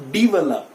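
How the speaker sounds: "develop" with an EE first vowel; correct pronunciation. Shown as a demonstration. Teacher showing the E sound, develop starting DEE. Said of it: "वो ई के sound में आएगा".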